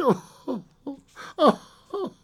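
high, heaving sobs; weeping. A narrator's reading. Old woman weeps afresh at the window, sobbing. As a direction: sob out in grief. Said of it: "and wept afresh".

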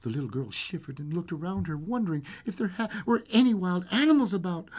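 lively storyteller with swooping pitch; storybook reading, animated; fairy-tale narration. A narrator's reading. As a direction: tell a bedtime tale with suspense. Reads fairy tale aloud.

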